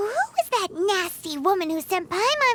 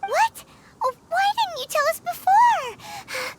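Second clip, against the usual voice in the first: alarmed surprise; 10 semitones higher than usual. Acted. Paimon alarmed at missed rite.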